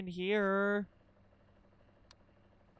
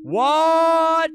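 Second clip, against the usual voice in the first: much higher, much louder; startled; 11 semitones higher, 15 dB louder than usual. Real, not acted. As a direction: blurt it in shock. Startled exclaim.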